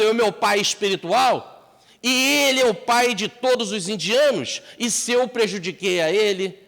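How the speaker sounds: high, loud anguished voice; remorseful thief. Acted. The thief regrets robbing Gandhi, loud and anguished.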